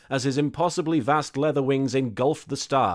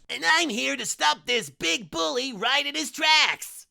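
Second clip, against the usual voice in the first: cheesy cartoon cat mascot, proudly; cartoon mascot hero; 13 semitones higher than usual. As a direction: announce yourself like a kids' TV cat hero.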